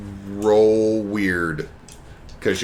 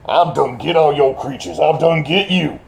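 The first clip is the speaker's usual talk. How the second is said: lightly Cajun villain voice; a hint of Cajun menace; Cajun accent; 4 semitones higher than usual.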